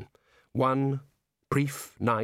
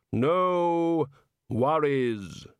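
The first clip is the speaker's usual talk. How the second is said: hollow, measured voice of Death; 5 semitones higher, 3 dB louder, much slower than usual. A narrator's reading. Death greeting Rincewind in his cell, calmly. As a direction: speak as Death, calm and final.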